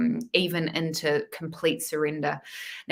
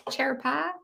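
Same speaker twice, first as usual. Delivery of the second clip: name repeated with a light American twang, rising; a hint of American twang on Chirpa; 8 semitones higher than usual. Shown as a demonstration. Repeating viewer's name with a light American twang. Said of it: "twang at the end".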